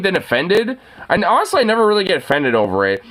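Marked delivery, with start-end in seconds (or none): none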